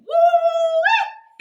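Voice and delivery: very high, loud yelp of remembered pain; a sharp cry as the needle goes in; pained woo. Retold or imitated. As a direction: let out a shrill, loud woo. Reacting to piercing pain.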